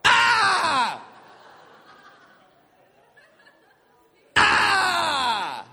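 high shouted fake yelp; mock pain scream. Boy faking pain from dad's hit. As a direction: yell as if it really hurt. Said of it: "I'm like".

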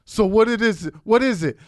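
loud, high pestering questions; insistent daughter. Retold or imitated. Daughter demanding to know dinner, loud and insistent. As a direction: imitate a kid demanding an answer.

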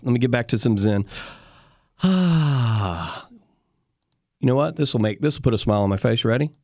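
ordinary delivery

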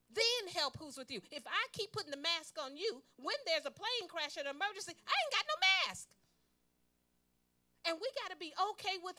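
loud, high, fervent preaching; preaching at full force. Acted. Preacher urges the congregation to say no. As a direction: rouse the church to agree.

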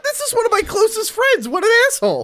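high, squeaky mock indignation; mock outrage. Real, not acted. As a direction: play it up as if wounded. Friend insults him on air.

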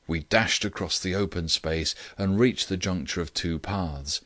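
ordinary delivery

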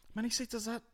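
high-pitched startled question; mock alarm. Acts out shock at the seats.

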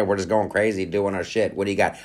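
ordinary talk

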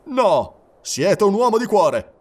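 high, loud rallying call; calling out to the troops. Acted. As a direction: summon volunteers. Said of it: "soggiunse rivolto ai suoi soldati". (il capitano chiama i soldati a gran voce)

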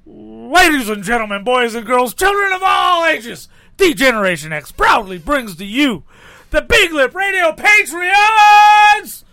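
booming announcer build to shout; ringmaster. Retold or imitated. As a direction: announce it like a wrestling ring announcer. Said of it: "Who was that?".

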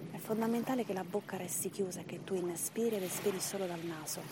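ordinary talk, nothing marked

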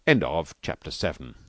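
usual speaking voice

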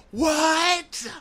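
squeaky, hammed-up disbelief; mock shock. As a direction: act out his outrage in a very high voice. Mocking his shocked denial.

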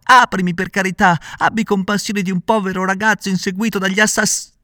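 high, loud pleading cry cut off; desperate shout. A narrator's reading. Pinocchio begging while chased, high loud shout. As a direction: beg for mercy at the top of the voice. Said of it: "gridava Pinocchio".

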